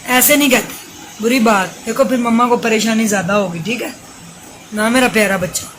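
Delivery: mother hushing her crying son late at night, loud and pleading. Real, not acted.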